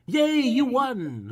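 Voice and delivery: slow, high cheer. Acted. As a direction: celebrate the victory. Friends cheer the win.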